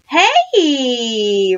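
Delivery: loud, drawn-out attention call; shouting. A narrator's reading. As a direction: holler to grab everyone's attention. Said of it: "Ryan shouted".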